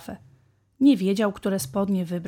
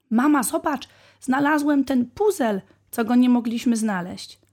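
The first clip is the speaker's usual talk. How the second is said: put-on excited child; 4 semitones higher, 5 dB louder than usual. A narrator's reading. Child excited about found puzzle.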